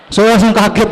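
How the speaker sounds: usual speaking voice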